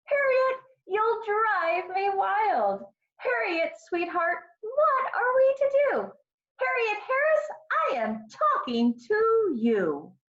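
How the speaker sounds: very high, stretched-out motherly scolding; exasperated mother. A narrator's reading. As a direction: build exasperation without yelling, ending slow and stern. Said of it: "Her mother didn't yell".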